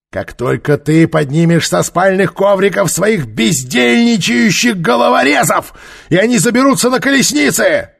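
high, loud, building roar; shouted order. Acted. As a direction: bellow at a subordinate to get moving. Ruler rouses his lazy officer.

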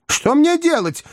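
very high, exasperated wail; crying out helplessly. A narrator's reading. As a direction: wail for help, pitched far up. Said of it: "крикнул мистер Уинкли". (отчаянный высокий крик)

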